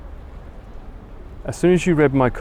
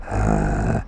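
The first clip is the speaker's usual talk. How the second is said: very deep creaky "uh"; low vocal fry. Shown as a demonstration. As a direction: let out a low fry from the throat. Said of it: "you'd be able to do a vocal throw on a low".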